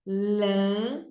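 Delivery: Lama split into "la... ma"; syllable by syllable. Shown as a demonstration. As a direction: say Lama slowly in two separate beats. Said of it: "Duas sílabas". (Lama em sílabas: "la... ma")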